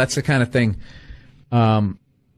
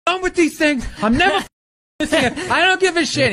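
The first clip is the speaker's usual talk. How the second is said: retold flip-out; 12 semitones higher, faster than usual. Comic calling his college agent after a gig, mock flipping out. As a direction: replay your own meltdown, pitched way up. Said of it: "flipping out".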